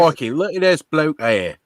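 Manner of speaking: put-on Australian accent that slips into Cockney; mock Australian accent. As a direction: play a hammed-up Aussie pointing at someone. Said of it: "that's more Cockney than Australian".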